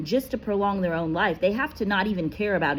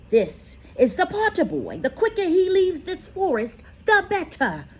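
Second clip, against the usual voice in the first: high, raised, insistent voice; firm centaur; 5 dB louder than usual. A narrator's reading. Firenze insisting to Bane, high and firm. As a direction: push back hard.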